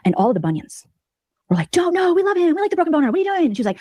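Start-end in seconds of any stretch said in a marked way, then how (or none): 1.7-3.5 high, whiny fan voices; imitating dismayed fans; mock protest. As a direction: protest like upset groupies.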